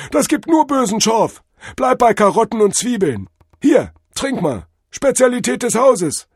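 very high, loud guru warning; jive-talking drug-vision god. A narrator's reading. God advises Cordell against overthinking, high loud guru. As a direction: warn him like a trippy guru. Figure of Tod Hermes.

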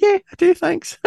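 very high-pitched mock girl voice; imitated young girl. Mate's daughter answering the Scottish visitor, imitated high girlish voice. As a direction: squeak it like a mate's little daughter. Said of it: "And she went".

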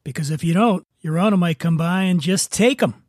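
ordinary delivery

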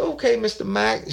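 high, put-on voice of the woman on the phone; old woman's voice. Woman answering a prank phone call, high put-on voice.

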